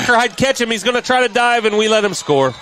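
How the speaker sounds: high, loud racing call; excited play-by-play. Real, not acted. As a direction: build the call with the runner. Breakaway touchdown run called live.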